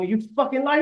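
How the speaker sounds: mock accusing yell. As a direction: catch him out with a loud high jab. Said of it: "I go".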